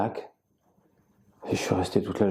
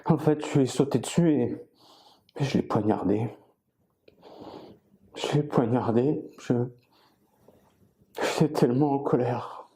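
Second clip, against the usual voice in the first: higher-pitched, agitated retelling with halting pauses; distressed confession; 4 semitones higher than usual. Acted. Confessing the stabbing to those who arrived, distressed. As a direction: relive it with rising distress, faltering between phrases.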